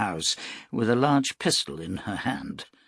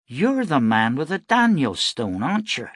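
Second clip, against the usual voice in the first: realising; 9 semitones higher, 5 dB louder than usual. Acted. Mother realises who he is.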